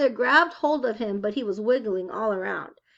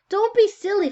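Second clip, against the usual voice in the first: high, louder mother's coaxing; 9 semitones higher, 7 dB louder than usual. Acted. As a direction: talk a toddler out of a fuss. Mother coaxes tantrum child.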